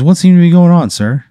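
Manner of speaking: high, projecting boss voice; booming problem-solver. Acted. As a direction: address the customer like the big man in charge. Same figure questions the customer.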